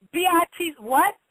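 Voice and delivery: mock-shocked reaction, voice lifted; indignant disbelief. Parent reacting to a cashier's insult, indignant disbelief.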